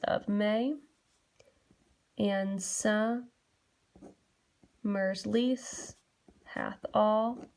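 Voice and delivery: slow scansion with pauses, "sum / mer's lease / hath all / too short / a date"; broken into metric feet. Shown as a demonstration. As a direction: read slowly foot by foot, pausing even inside "summer's". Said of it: "I literally do that for all".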